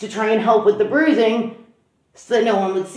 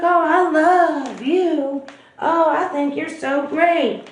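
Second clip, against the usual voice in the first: drawn-out, higher, lovestruck gushing; mock lovey-dovey, stretch the words out slowly; 5 semitones higher, much slower than usual. Gushing new girlfriend acted out.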